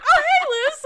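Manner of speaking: a friend imitating the hero's offhand greeting, high and loud; mock Lockwood. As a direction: toss off a hammed-up casual hello. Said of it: "He's just like".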